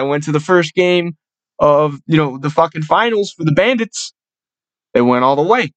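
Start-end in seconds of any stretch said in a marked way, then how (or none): none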